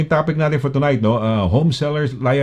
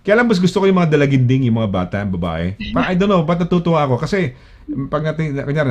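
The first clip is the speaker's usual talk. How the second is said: ordinary delivery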